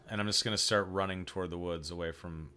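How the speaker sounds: ordinary talk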